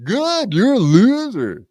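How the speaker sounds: high, drawn-out taunting sing-out; mock gloating. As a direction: rub it in playfully, pitch way up. Gloating at co-host's mock crying.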